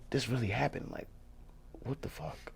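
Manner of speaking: very soft, to yourself; hushed, dazed inner thought; barely voiced bewilderment; retold stunned disbelief. Retold stunned thought after leaving.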